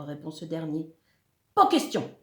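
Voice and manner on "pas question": high, loud burst; monster's indignant outburst; outraged refusal. A narrator's reading. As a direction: refuse sharply at the top of your voice.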